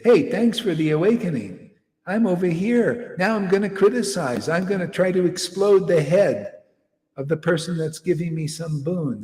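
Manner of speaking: a mildly mocked, cocky voice of an ingrate; mocking ungrateful taker. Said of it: "like a prostitute and say".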